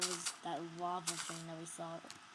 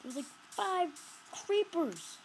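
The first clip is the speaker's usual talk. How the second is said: high, louder worried cry; higher, louder frightened count; alarmed; 9 semitones higher, 6 dB louder, slower than usual. Real, not acted. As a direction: yelp in alarm. Alarmed at creepers.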